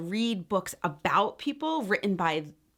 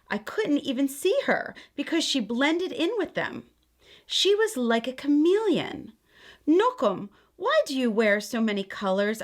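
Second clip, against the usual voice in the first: gentle, expressive read-aloud; storybook reading; 4 semitones higher than usual. A narrator's reading. Aunt reading a picture book to children, gentle storytelling. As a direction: read it like a bedtime story for kids.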